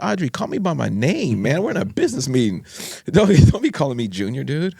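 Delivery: acting out a peeved plea to be taken seriously; exasperated protest. Brother to sister in a business meeting, exasperated.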